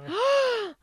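high, sharp intake of breath; big startled gasp; excited gasp. Real, not acted. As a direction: gasp in high-pitched surprise. Reacting to host being back.

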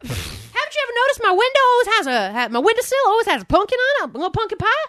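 very high, loud hillbilly voice; mock Southern local defending pumpkin snatching. Continuing the hillbilly bit.

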